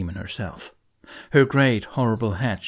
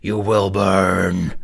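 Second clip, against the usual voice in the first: slow, much louder monstrous threat; demon threat; 2 semitones lower, 7 dB louder, much slower than usual. A narrator's reading. Demon threatening the humans, slow booming threat. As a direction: draw each word out like doom. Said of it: "the demon screamed".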